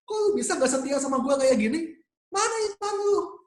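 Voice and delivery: high, hammed-up scolding as an imagined angry Jesus; exaggerated high-pitched rebuke; mock scolding Jesus. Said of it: "Yesus nggak lalu maki-maki gitu ya".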